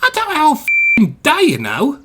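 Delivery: high, loud angry screech; shriek. A narrator's reading. Small engine berates Henry, shrieking. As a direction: keep screeching impatiently. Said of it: "Percy shrieked".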